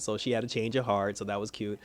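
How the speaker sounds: ordinary delivery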